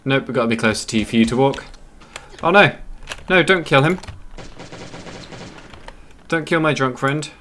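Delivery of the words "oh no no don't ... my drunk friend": sudden alarmed cry. Real, not acted. Alarm at game event.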